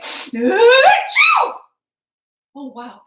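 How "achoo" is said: loud, high hammed-up achoo; big fake sneeze. Teacher acting the ant's sneeze for the children, hammed-up. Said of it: "That was a big sneeze".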